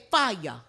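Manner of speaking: "fire" as "fi-yah"; Gullah FIRE. Shown as a demonstration. Teacher prompting the audience to repeat, "fire" said as Gullah "fi-yah". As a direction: say "fire" with an open "yah" ending. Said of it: "Let me hear you say".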